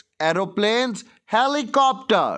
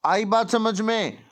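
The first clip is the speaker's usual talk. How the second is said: usual speaking voice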